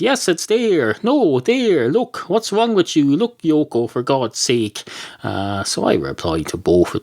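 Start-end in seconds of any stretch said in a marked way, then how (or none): 0.0-4.8 higher, impatient, mildly exasperated; exasperated reply. Retold or imitated. Podcaster replying to Yoko about the horizon. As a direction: sigh it out at someone dim. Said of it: "I Am Idiot ... said".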